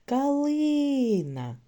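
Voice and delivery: «Калина» called out high and slow, long «и»; called out for stress. Shown as a demonstration. Teacher helping children find stress, «Калина» called out, middle syllable drawn out. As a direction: hoot the word, stretching «ли» in «Калина». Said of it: "Гукайте".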